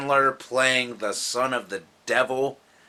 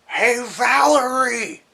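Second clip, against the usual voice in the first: high, drawn-out possessed call; loud, silly character impression; Adam Sandler; 11 semitones higher, 5 dB louder, slower than usual. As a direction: holler it high and stretched out. Imitates possessed Sandler calling out.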